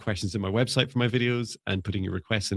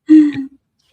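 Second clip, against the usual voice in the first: excited squeal; 13 dB louder than usual. Real, not acted. Amused banter between hosts.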